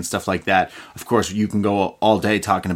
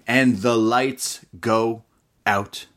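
ordinary delivery

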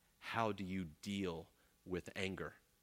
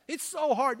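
high, loud whining mimic; mock whine; 11 dB louder than usual. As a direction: parody the complainer. Mimicking complaint again.

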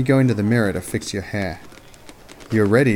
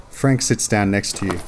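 ordinary delivery

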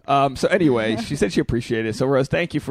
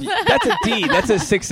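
laughing; 9 semitones higher, 3 dB louder than usual. Real, not acted. Joking about four-star reviews.

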